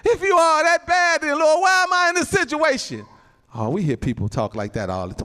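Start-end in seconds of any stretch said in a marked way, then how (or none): 0.0-3.1 loud, high-pitched mock complaint; challenging God. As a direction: demand an answer from the Lord, imitating a doubter. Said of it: "that's how I was talking".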